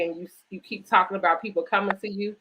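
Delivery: usual speaking voice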